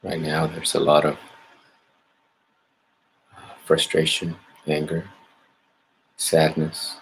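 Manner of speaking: calm, measured meditation guidance with long pauses; guided meditation. Acted. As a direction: gently lead listeners inward, leaving space after each phrase. Leads a meditation.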